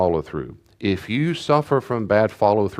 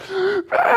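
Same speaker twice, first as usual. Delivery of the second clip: imitating a child's gasping sob; a quick high catching gasp. Imitating son's crying gasp.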